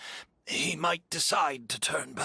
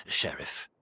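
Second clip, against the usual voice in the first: ordinary delivery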